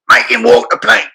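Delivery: high-pitched, punchy Cockney; Cockney accent. Shown as a demonstration. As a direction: belt it out as a Cockney pirate line. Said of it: "how to say the line as a Cockney".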